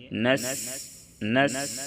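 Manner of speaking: نس with a sharp whistled s; نس with a whistling sibilant. Shown as a demonstration. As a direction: hiss the s of نس like a whistle. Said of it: "سیٹی کی آواز نکال کر".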